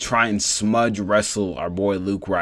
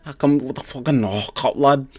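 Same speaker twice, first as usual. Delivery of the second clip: lightly voiced, higher cocky Scouse fighter; post-fight speech; 4 semitones higher than usual. Fighter boasting in his post-fight speech, a hint of a higher cocky Scouse voice. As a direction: brag it like a fighter on the mic. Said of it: "He came out on the post-fight speech".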